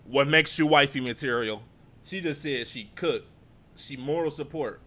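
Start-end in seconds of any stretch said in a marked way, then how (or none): 0.1-1.6 louder mocking repeat of the host's question; pointed loud repeat. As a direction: repeat it back with emphasis. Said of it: "You hear the".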